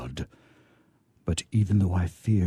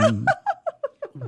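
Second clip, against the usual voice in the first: light airy laugh after the compliment; 4 semitones higher than usual. Acted. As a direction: laugh it off gently. Angel laughs while flirting.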